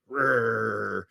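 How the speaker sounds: a small playful growl; cute little growl. Retold or imitated. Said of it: "lets loose a cute little growl".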